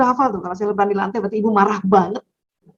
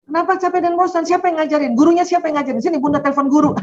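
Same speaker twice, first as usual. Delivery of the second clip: 1 panicky mother; 7 semitones higher, faster than usual. Retold or imitated. Panicked mother interrogating child.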